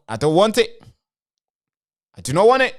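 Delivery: from firm to high and loud, I do not want it; building insistence. Real, not acted. To viewers about civil war, rising insistent refusal.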